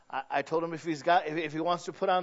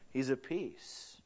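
soft and slow, much quieter; hushed contentment; 5 semitones lower, 11 dB quieter, much slower than usual. Retold or imitated. Son watching his father eat, hushed and slow. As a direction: breathe it out softly and slowly, content.

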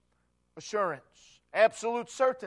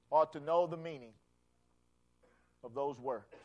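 usual speaking voice

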